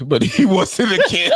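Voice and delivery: high, loud, laughing mock protest; laughing retort. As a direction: crack up mid-quote, voice jumping up. Joking retort, laughing through it.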